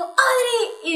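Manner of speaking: high-pitched mock telling-off; scolding teacher. Teacher scolding a student by name, mock high-pitched. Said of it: "cuando me llegó a regañar".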